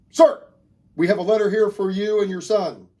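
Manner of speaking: crisp barked "Sir", then official delivery; military messenger. Acted. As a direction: announce orders like a soldier at attention. Soldier delivering orders at door.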